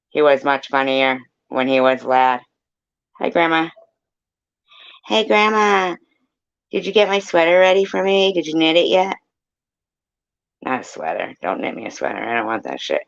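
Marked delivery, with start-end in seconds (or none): 3.2-9.2 higher, eager grandkid voice; playful kid asking grandma; grandchild voice. Pretend. Voicing a grandchild. 10.6-13.0 grumbled. Pretend. Grumpy reply.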